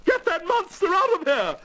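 very high, panicky Queen shrieking; hysterical screech. Acted. Frightened Queen calls out the guards, hysterical screech. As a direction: screech for help in terror. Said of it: "screeched the frightened Queen hysterically".

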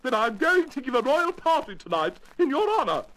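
high, gushing royal lady voice; effusive thanks in a high regal voice; grateful Queen. Acted. Queen thanks Alice for getting rid of the mouse, gushing.

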